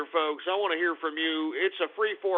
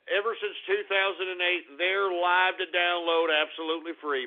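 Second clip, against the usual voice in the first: ordinary talk, nothing marked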